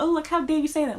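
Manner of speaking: sarcastic how-dare-you imitation; mock outrage. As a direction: parody offended viewers. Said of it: "Everybody gonna get mad and be like".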